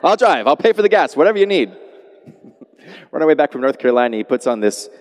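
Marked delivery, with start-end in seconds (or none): 0.0-1.8 a touch louder and higher, eager yes-man; eager agreeing. Pretend. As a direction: hurry to agree with the father-in-law. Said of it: "just say".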